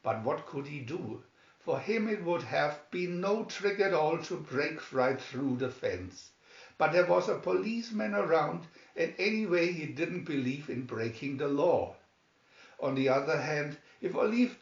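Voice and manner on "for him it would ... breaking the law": ordinary delivery, nothing marked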